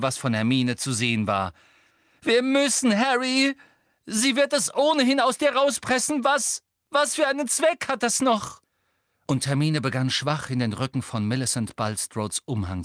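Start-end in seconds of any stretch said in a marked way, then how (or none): 2.2-8.6 sehr hoch und laut; verzweifelt flehend. A narrator's reading. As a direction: flehe ihn schreiend an. Hermine fleht schreiend, weint danach. (hohes, lautes, verzweifeltes Drängen)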